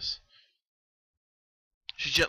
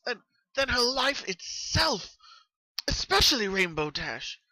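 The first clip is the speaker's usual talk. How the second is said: high, louder, aching narration in Pinkie's voice; Pinkie's anguished love; 11 semitones higher, 4 dB louder than usual. Acted. Narrator slipping into Pinkie's voice about Rainbow Dash, high and pained. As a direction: pour out how much she loves her friends.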